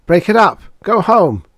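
high, loud, furious shouting; irate yelled orders; angry yell. A narrator's reading. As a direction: shout angrily to drive off a crowd. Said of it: "Drummond yelled angrily".

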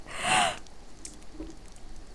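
long breathy yawn; a tired open yawn. Real, not acted. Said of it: "Sorry for yawning".

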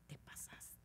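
whispered aside; whispered reproach. Retold or imitated. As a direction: scold a colleague under the breath. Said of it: "Y yo". (reproche en susurro a Gaby)

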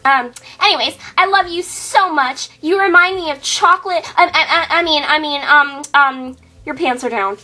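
ordinary talk, nothing marked

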